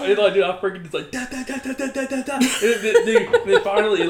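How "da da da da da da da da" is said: high, staccato rifle-fire noise; imitated rapid gunfire. Boy emptying the magazine, imitated rapid gunfire. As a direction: rattle off shots like a repeating rifle.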